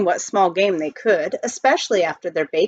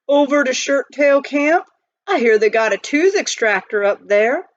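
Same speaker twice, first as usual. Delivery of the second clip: gravelly miner; 6 semitones higher, 5 dB louder than usual. A narrator's reading. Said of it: "as if he had a mouthful of gravel".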